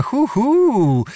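high, drawn-out "hoo-hoo"; teasing high-pitched hooting. A narrator's reading. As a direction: hoot playfully, pitched well up. Teasing witch hoots at the Cossack.

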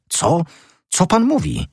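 very high, incredulous exclamation; startled cry. A narrator's reading. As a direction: cry out in disbelief at normal volume. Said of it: "zawołał pan Fiel". (okrzyk zdumienia)

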